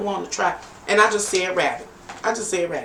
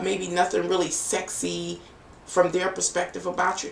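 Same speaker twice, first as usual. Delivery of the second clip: ordinary talk, nothing marked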